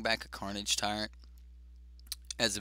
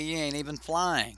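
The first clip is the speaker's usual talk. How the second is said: louder, higher-pitched card-game trash talk; playful taunt; 6 semitones higher, 4 dB louder than usual. Pretend. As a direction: needle the opponent in a raised voice. Trash-talking the opponent's Phoenix card.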